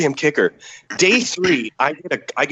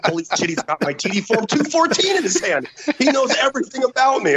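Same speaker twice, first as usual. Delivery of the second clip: high-pitched, astonished retelling; astonished disbelief; 9 semitones higher than usual. Retold or imitated. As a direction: let the amazement climb higher and higher. Retelling his astonished reaction to general.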